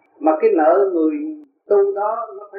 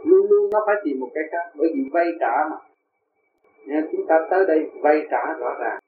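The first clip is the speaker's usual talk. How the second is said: usual speaking voice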